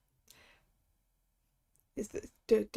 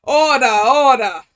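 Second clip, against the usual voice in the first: loud, drawn-out call for order; judge calling order; 9 dB louder, slower than usual. Acted. Acting a judge calling court to order.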